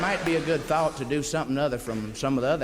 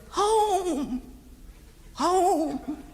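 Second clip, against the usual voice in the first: very high, chirpy bird cry; little bird crying out. Pretend. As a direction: squeak it out like a tiny bird warning the loggers. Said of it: "and he says".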